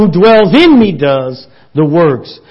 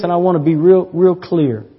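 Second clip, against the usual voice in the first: normal talking voice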